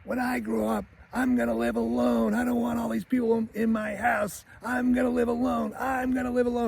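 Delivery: put-on high-pitched little-boy chant; defiant kid. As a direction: imitate a kid declaring it while hopping about. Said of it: "he starts jumping up and down".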